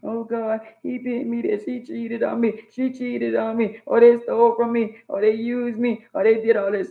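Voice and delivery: mock whining. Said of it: "you crying and you moping".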